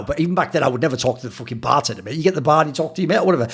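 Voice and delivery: ordinary delivery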